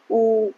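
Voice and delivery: ऊ held with round lips; rounded ऊ demo. Shown as a demonstration. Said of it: "जैसे मेरे मुंह की तरफ देखिए".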